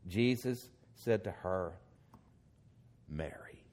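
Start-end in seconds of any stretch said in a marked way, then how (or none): 3.1-3.6 "Mary" dropped very soft and low; tender call. Acted. Jesus gently naming Mary at the tomb, very soft. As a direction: say her name gently, almost under the breath. Jesus calls her name softly.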